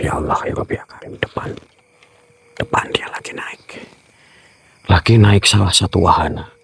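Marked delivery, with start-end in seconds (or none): 0.0-3.8 hushed whisper of alarm. Real, not acted. Ghost hunter spotting a figure on the ride, hushed whisper. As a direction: breathe it so the ghost won't hear.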